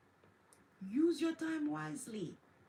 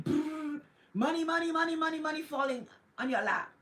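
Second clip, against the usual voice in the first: excited money rain; 3 semitones higher, 4 dB louder than usual. Acted. Playful money-rain image.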